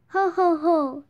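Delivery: loud jolly Santa ho ho ho; loud Santa laugh surprising the fly. Acted. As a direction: boom it out like Santa. Santa's voice in the dark.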